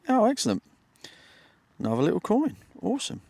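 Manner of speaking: high, delighted exclamation; breathless treasure hunter. Real, not acted. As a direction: pitch jumps up with joy. Digs up a coin.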